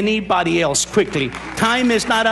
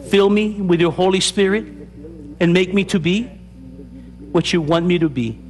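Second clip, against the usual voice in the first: led prayer; 4 semitones lower than usual. Acted. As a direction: pray it line by line so they can follow. Leads the sinner's prayer.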